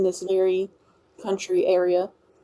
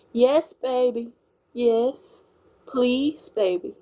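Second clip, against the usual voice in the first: slightly raised Southern lilt on the endearments; own Louisiana accent; 4 semitones higher, much slower than usual. Shown as a demonstration. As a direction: play up the Louisiana accent a little. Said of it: "when they have your accent".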